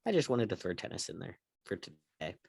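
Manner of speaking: ordinary talk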